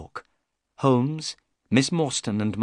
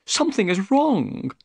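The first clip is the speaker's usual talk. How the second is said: raised, brighter voice for Thaddeus Sholto; Sholto's eager voice; 10 semitones higher, 3 dB louder than usual. Acted. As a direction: voice the excitable little man, pitched up.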